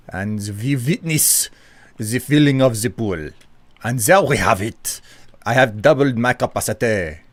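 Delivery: put-on French accent. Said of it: "my beautiful, uh, French accent".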